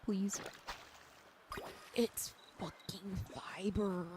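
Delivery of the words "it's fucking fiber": hissed under the breath, slow; real frustrated whisper; annoyed whisper. Real, not acted. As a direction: spit it out in a whisper at the junk item. Annoyed at getting fiber.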